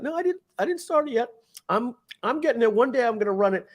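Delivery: mock the dreamer's excuse, high; imitating Jerry's excuse.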